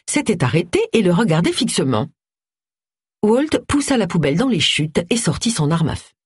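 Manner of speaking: usual speaking voice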